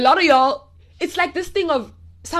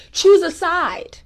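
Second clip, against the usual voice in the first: emphatic call; 5 semitones higher, slower than usual. Real, not acted. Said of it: "Luke, no, no, no".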